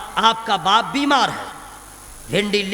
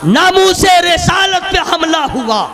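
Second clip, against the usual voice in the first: raised, rising sermon voice; fiery preaching; 8 semitones higher, 5 dB louder than usual. Acted. Preacher stirring the crowd, rising. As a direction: build the preaching louder.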